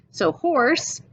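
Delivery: ordinary delivery, nothing marked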